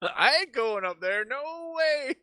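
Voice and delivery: high, slow, drawn-out wail; hammed-up scaredy-cat voice; mock-frightened refusal. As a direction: climb into a squeaky "no way".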